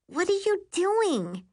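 put-on groggy kid just woken up; sleepy little sister.

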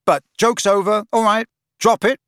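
loud, high, cutting off the joke; impatient. A narrator's reading. Friend ending the joke, impatient. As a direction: order him to stop, impatiently. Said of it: "said Harry impatiently".

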